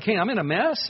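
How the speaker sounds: bright, high, upbeat "Hey, I'm in a mess". Retold or imitated. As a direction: say it cheerily, as if no big deal. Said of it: "it actually seems a little upbeat".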